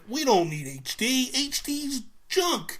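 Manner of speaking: high, slowed sneer; mock corporate smugness. Mocking Nintendo's Wii-era stance on HD, smug. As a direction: parody the company line.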